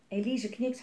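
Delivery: plain reading voice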